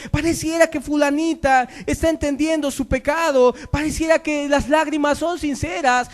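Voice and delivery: high, sustained pulpit cry; impassioned preaching. Acted. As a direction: build a relentless exhortation to the congregation. Preaches at full force.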